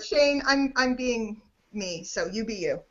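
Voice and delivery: normal talking voice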